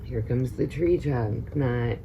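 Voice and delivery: ordinary delivery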